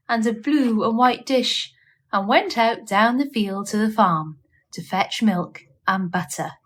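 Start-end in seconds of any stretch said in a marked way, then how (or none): none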